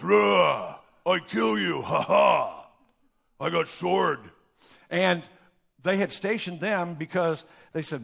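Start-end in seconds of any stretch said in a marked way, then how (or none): 0.0-4.2 mock caveman soldier growl; brutish guard. Preacher mocking Roman guards as brutes, hammed-up. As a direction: ham up a dumb tough guard. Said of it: "They were just".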